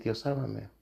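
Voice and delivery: soft, pleading cry upward; hushed plea to God. Retold or imitated. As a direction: beg softly for rescue. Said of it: "voltear hacia arriba y decir". (súplica suave a Dios)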